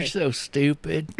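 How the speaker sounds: slow, high mock sweet insult; condescending chuckle. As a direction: talk down sweetly.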